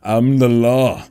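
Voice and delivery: a hint of a stern movie cop; Judge Dredd. On the Judge card, a mild Judge Dredd voice. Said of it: "Judge Dredd".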